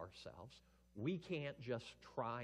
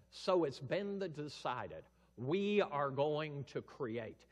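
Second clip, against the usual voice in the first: slow, loud, solemn proclamation; grand Father voice; 4 semitones higher, 10 dB louder, slower than usual. Acted. Preacher voicing God the Father, slow booming decree. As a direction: announce it slowly like a king on a throne. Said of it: "The Father says".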